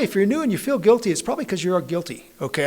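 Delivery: ordinary talk, nothing marked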